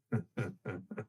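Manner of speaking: soft low sinister chuckle. Acted. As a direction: chuckle darkly under the breath. Creature chuckles quietly.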